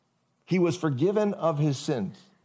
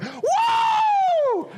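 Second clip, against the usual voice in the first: high, loud, ecstatic whoop; joyful whoop; 5 dB louder than usual. Pretend. Preacher imagining himself as the healed man, whooping. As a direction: let out a wild cry of joy. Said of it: "I'd be like".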